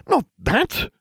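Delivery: high-pitched, exasperated retort; snappish. A narrator's reading. Friend cuts off the narrator's flippant answer, snappish. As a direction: snap it, high and impatient. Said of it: "she snapped".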